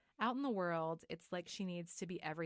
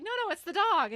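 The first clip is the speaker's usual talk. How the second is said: high, loud hammed-up denial; frantic insistence; 13 semitones higher, 15 dB louder than usual. Woman blaming the dog, frantic mock denial. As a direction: insist loudly, covering up. Retold insistent cover story.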